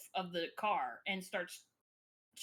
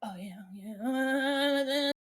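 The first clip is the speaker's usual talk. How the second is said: loud, drawn-out "oh yeah"; aggressive groove; 4 semitones higher, 5 dB louder, much slower than usual. Retold or imitated. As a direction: stretch the last yeah out, higher and louder. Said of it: "it's like an aggressive head nod".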